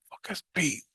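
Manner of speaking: hushed, high-pitched inner exclamation; unspoken astonished thought. Retold or imitated. Man silently recognizing his dad, hushed astonishment. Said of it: "I didn't say that out loud".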